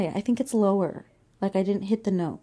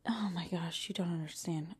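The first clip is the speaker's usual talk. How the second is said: whispering; 6 semitones lower, 13 dB quieter than usual. Real, not acted. Frustrated aside.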